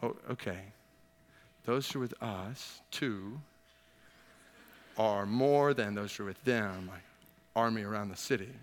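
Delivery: mock the servant's skeptical reply, quietly; doubtful servant. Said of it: "Put yourself in the servant's shoes".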